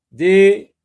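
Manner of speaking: high, loud stress on Des; emphatic dictation. Shown as a demonstration. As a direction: punch Des out for the class. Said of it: "D E S".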